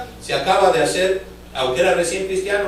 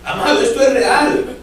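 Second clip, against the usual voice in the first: higher and louder outburst; fervent exclamation; 6 semitones higher, 6 dB louder than usual. Real, not acted. Preacher to his beloved flock, loud and fervent. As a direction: cry it out to the congregation.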